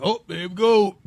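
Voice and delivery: mock old-timer voice; sixty-year-old self. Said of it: "I'm gonna be sixty years old".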